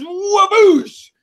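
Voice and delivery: high, drawn-out whoosh; imitated slap impact. Podcaster describes an epic slap, exploding whoosh. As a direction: make the slap land with a big swoosh.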